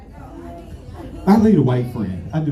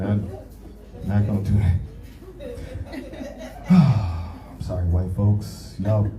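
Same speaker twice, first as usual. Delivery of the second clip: lower, slow fake-sorry voice; mock remorseful apology; 5 semitones lower, 3 dB quieter, slower than usual. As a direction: pretend to beg forgiveness.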